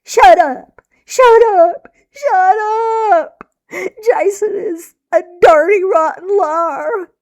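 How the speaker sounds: high, loud crying yell. A narrator's reading. Girl yelling at her mother to shut up, crying. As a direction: yell it through the sobs. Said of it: "the whole time I was yelling".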